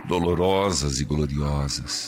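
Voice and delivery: prayer leading. Acted. Priest leads repeat-after-me prayer. (oração lenta e pausada)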